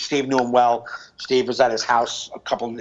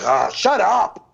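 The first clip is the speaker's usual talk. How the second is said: slow, higher "oh, shut up" from the kitchen; mock annoyed ex; 6 semitones higher, 3 dB louder, much slower than usual. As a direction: drag it out like an irritated ex. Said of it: "hear it from the kitchen and be like".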